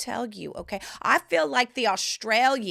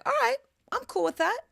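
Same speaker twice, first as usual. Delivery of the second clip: mock higher laid-back guy voice; light dude voice, quick; playful impression of the easygoing ex; imitating ex; 8 semitones higher than usual. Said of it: "And he was like".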